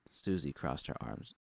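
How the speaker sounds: ordinary delivery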